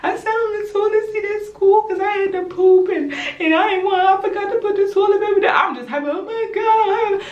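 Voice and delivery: high-pitched, hammed-up tearful wail; mock crying. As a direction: imitate yourself bawling out a panicked confession. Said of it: "I start crying".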